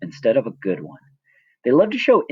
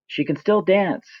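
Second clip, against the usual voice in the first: mock reporter; 9 semitones higher than usual. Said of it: "they say".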